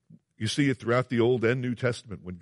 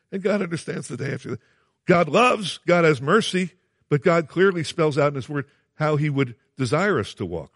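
rising higher and louder into full-force preaching; preaching build; 6 semitones higher, 5 dB louder than usual. Real, not acted. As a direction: build from quick to thundering. Sermon builds to a peak.

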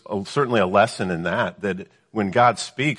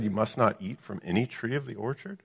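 softer sceptical question, rising through "orchard"; doubting emphasis; 8 dB quieter than usual. Acted. Devil asking the woman about God's command, doubting emphasis. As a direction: let "any tree of the orchard" climb in disbelief. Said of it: "do you see the emphasis?".

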